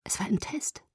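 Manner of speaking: soft, lightly voiced near-whisper; hushed repeat. A narrator's reading. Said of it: "flüsterte ich".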